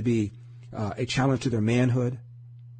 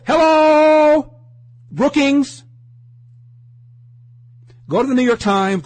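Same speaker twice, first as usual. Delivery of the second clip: loud, high mocking call; sarcastic shout; 14 semitones higher, 10 dB louder, slower than usual. Real, not acted. Host shouting at listeners to notice Brookings, mocking. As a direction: yell it to make the point.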